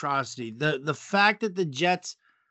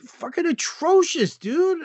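disgusted outburst; 10 semitones higher than usual. Real, not acted. Podcast co-host venting about the Jets win, disgusted outburst. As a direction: blurt it out, fed up with the collapse.

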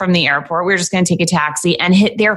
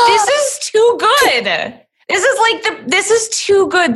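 thrilled; 10 semitones higher than usual. Real, not acted. S1 thrilled listener exclaims.

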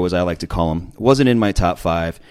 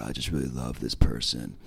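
low, much softer voice pushed back in the throat; throaty imitation; 10 semitones lower, 9 dB quieter than usual. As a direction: talk from the back of your throat like a mushy guy. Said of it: "They both talk in the back of their throat like this".

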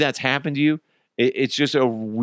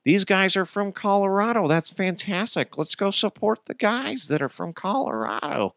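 mock moviegoer; 7 semitones higher than usual.

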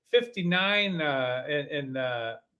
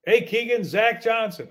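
loud, higher-pitched, punchy hello; upbeat good-news greeting; 5 semitones higher, 5 dB louder than usual. Retold or imitated. Captain calling as if the player made the team, upbeat. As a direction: announce yourself brightly, as if bringing good news. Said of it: "that might make you think".

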